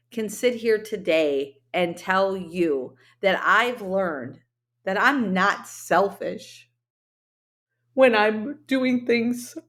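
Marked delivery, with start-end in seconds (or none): 4.8-9.6 slow, halting words as emotion rises; real feeling catching her mid-sentence; getting emotional. Real, not acted. Host admits to listeners she's getting emotional, voice slowing. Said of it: "I don't know why I just got emotional".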